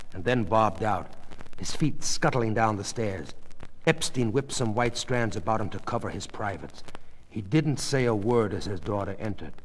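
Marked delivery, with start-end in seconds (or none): none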